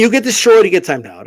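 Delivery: ordinary delivery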